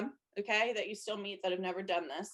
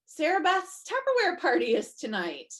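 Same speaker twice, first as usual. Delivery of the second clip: higher, louder delighted discovery; excited scroller; 7 semitones higher, 5 dB louder than usual. Pretend. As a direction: gush as if spotting something cute, higher. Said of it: "they're gonna go".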